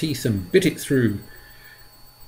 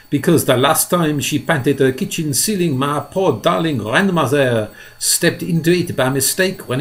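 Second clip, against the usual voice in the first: lightly voiced spider, a touch higher; Miss Spider voice; 5 semitones higher, 5 dB louder than usual. A narrator's reading. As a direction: tell the sad tale with feeling. Miss Spider's sad family story.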